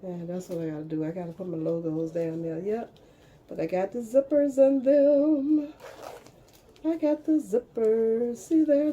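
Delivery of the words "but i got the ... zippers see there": excited. Real, not acted. Proudly showing off finished bags.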